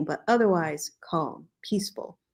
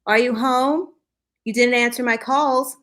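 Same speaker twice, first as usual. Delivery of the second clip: calling through the house, high and loud; 7 semitones higher, 9 dB louder than usual. Acted. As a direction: call out from the front door. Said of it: "a familiar voice called".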